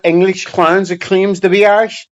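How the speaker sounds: usual speaking voice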